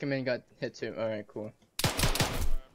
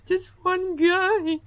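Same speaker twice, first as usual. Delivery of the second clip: incredulous whine; 10 dB louder, much slower than usual. Real, not acted. Stressing a single enemy won.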